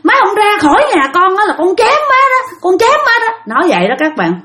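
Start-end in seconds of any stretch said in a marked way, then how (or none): 0.0-3.3 high, hot threat to a possessed mother; retold knife-in-hand threat, voice pitched high; furious threat. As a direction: throw the threat out in a torrent. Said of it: "nói xối xả".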